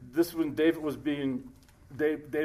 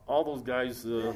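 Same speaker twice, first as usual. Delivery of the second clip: ordinary delivery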